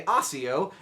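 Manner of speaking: "Accio" with the first c like s; author's pronunciation. Shown as a demonstration. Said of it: "J K Rowling says you should".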